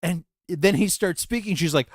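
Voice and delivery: normal talking voice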